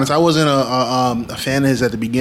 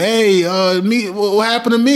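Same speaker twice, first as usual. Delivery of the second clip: higher, confused, pleading; lightly voiced girlfriend, higher and confused; mock whiny girlfriend; 7 semitones higher than usual. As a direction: whine it up high like she's left behind. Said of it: "his shorty was like".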